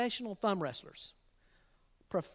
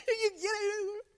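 high laughing question; 12 semitones higher, slower than usual. Real, not acted. Dad laughing over his own pun. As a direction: giggle it out slowly.

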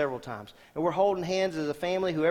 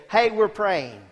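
bright, louder call to the room; retold call; 5 semitones higher, 8 dB louder, slower than usual. Patient tells the doctor walking in, a mild mock call. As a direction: announce it cheerfully to the nurse. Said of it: "he would say".

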